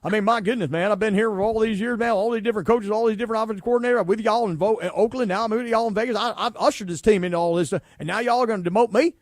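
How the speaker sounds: indignant mock Southern accent, running on; Derek Carr. Host imitating a demoted quarterback pleading his case, indignant Southern accent. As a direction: protest in a put-on Southern twang. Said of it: "I don't get the Southern accent".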